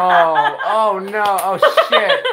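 loud, high laughing outburst; laughing in dismay. Real, not acted. Streamer reacting to a mishap on stream, laughing hard. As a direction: crack up loudly while swearing.

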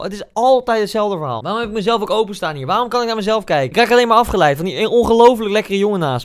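ordinary talk, nothing marked

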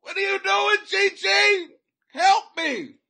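high, loud, exasperated holler; hammed-up frantic shout; mock desperate yell. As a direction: yell at them to come and help. Mock scolding plea for help.

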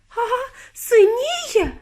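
very high, drawn-out alarm; horrified yelling. A narrator's reading. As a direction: wail it out in horror. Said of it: "репетувала пані Борогар".

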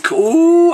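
loud high ooh; hammed-up amorous ooh; mock swoon. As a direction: coo it loudly. Mock love scene.

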